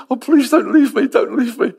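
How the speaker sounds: pleading. Acted. Elisha begging Elijah not leave.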